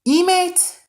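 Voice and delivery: "Emit" tried as ee-mate, high and loud; trying a pronunciation. Shown as a demonstration. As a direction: sound out "Emit" with a long ee and an ay. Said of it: "is that how you pronounce it?".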